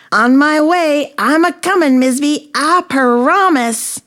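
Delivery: high, very loud holler; Frisbee, calling out. A narrator's reading. Bee careens across the room, calling out high and loud. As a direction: holler it high while flying past. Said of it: "he called out".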